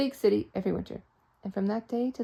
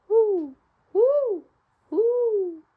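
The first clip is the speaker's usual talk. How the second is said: high, loud hooting owl; 12 semitones higher, 7 dB louder, much slower than usual. Acted. Storyteller hooting the owl that wakes Mister Flibbertigibb. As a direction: hoot like an owl in the dark.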